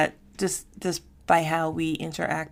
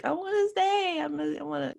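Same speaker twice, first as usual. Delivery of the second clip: put-on childlike protest; 12 semitones higher than usual. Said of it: "or were you like".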